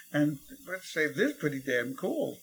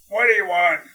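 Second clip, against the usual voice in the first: crabby lady; 5 semitones higher, 10 dB louder than usual. Retold or imitated. Crabby old lady at door.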